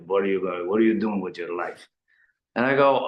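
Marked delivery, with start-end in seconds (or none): 0.1-1.9 imitated broken-English accent at usual volume; lightly voiced dad in broken English; father's broken accent. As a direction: repeat dad's question in his broken accent. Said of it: "in a really broken accent".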